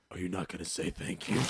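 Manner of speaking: low mock Batman growl. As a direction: demand it in a gruff hero voice. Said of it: "we cannot take you seriously".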